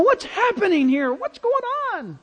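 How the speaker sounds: high-pitched imitation of bewildered questioning; dazed possessed man. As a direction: voice a man coming round, pitch climbing sharply. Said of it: "And he's going".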